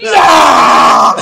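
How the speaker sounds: mock scream, very loud, in her face. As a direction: scream it at the top of your lungs. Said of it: "start screaming at top of my lungs".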